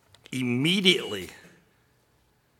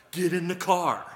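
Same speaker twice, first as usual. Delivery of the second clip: stern parent order; 4 semitones higher, 10 dB louder than usual. Retold or imitated. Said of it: "It's like a parent saying".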